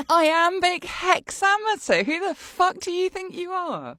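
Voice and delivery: high, put-on affronted voice; hammed-up high outrage; mock theatrical indignation. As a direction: challenge them in a high-pitched pompous voice. Mocking Shakespearean verse in high voice.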